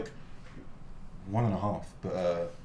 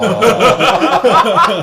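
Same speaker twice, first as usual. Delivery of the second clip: big loud laugh; loud burst of laughter; 10 semitones higher, 17 dB louder than usual. Real, not acted. Fan mistakes him for PewDiePie.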